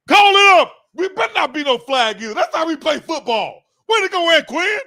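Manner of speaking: high, loud celebration of a big play; real hyped shout. Real, not acted. Fan cheering the defense, hyped shouting. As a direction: yell praise at the player on the field.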